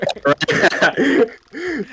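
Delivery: high-pitched burst of laughter; big laugh. Real, not acted. Streamer's guest cracking up at a joke about viewers, high laugh.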